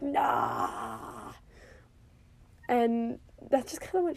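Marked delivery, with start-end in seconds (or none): 0.0-1.3 short, low mock roar of nah; mock scream. Retelling how Jon yelled at the dragon, low mock roar. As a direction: growl out a brief low yell. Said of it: "Jon screamed at the dragon".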